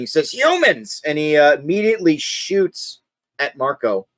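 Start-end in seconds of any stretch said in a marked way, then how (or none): 0.3-1.0 hobgoblin shout. Acted. Hobgoblin shouts on spotting the party, mock shout. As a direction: call it out high, like a startled hobgoblin. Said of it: "he just shouts something".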